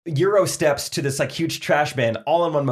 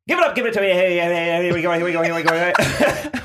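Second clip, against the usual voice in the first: auctioneer; 5 semitones higher than usual. Pretend. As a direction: rattle off the chant, pitched up. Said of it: "Auctioneer, take it away".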